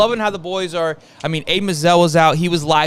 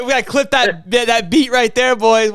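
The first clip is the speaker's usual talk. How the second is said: hyped up; 7 semitones higher, 5 dB louder than usual. Real, not acted. Hyped after hearing the recruit.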